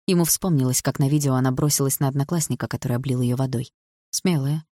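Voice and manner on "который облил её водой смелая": ordinary delivery, nothing marked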